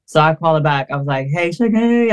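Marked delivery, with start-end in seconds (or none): 1.3-2.1 gentle high coo. Retold or imitated. Calling crying manager back.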